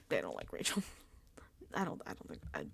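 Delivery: a conspiratorial whisper, then trailing off; whispered aside. Real, not acted. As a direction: drop to a secret whisper. Gossiping aside, dropping voice.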